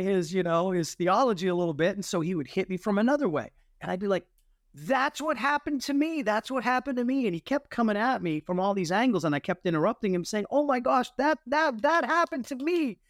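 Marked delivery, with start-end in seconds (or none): 4.7-7.3 high-pitched, eager retold outburst; excited recognition. Young convert interrupting the officer's witnessing, excited recognition. 10.5-13.0 very high, breaking in with excitement; squeal-pitched retold interjection without shouting; amazed recognition. Young convert interrupting the flight commander, amazed excitement.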